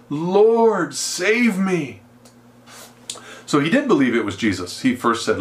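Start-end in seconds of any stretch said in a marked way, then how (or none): 0.1-2.0 high-pitched desperate plea to be saved; crying out. Acted. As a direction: cry out for rescue, drawn out. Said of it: "He cried, saying".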